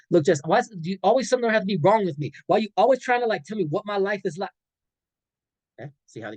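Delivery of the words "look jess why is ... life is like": higher, louder defensive comeback; defensive boyfriend. Acted. Boyfriend answers his girlfriend's concern, higher and louder and defensive. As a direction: snap back, all defense.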